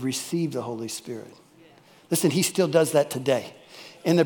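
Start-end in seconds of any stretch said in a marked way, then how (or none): 0.0-1.3 soft, hushed, as if breathed out; hushed breathed words. A narrator's reading. Jesus to the disciples, hushed and breathy. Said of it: "And he breathed on them".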